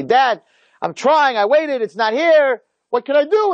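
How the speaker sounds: high, drawn-out protest to dad; pleading excuse. Acted. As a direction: plead helplessly, stretching the words in a high voice. Rehearsing pleading excuse to father.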